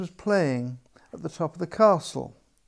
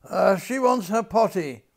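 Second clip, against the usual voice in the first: crying out; 4 semitones higher, 4 dB louder than usual. A narrator's reading. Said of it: "cried the general".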